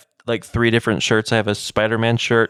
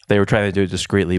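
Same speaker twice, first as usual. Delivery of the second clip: usual speaking voice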